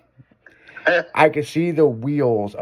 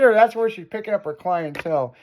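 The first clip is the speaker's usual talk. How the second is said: usual speaking voice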